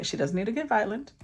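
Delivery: usual speaking voice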